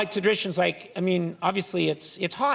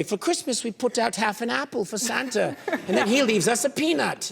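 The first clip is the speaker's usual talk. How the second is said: mock Belgian explaining a custom, pitched up; imitated Belgian; 6 semitones higher than usual. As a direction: lightly imitate a proud Belgian in a higher voice. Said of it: "you'll be in Belgium, and they'll say".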